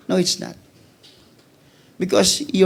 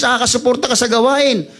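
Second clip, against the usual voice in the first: ordinary talk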